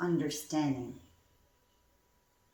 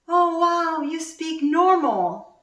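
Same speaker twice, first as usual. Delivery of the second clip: much higher, louder and slow, delighted surprise; amazed friend; 10 semitones higher, 7 dB louder, slower than usual. Retold or imitated. Friend in Canada on the phone, loudly amazed and slow. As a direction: stretch it out like an old friend amazed. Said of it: "she says to me".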